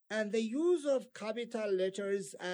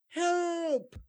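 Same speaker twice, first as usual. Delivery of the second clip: a loud, high call for help; urgent cry for help; 7 semitones higher, 4 dB louder than usual. Shown as a demonstration. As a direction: shout it high and loud like you're in danger. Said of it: "to show strong feeling".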